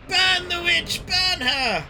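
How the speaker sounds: high mock mob yell; angry mob leader. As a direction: rouse the mob, hammed up. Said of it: "it's a witch".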